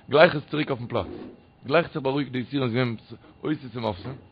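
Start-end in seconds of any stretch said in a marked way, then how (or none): none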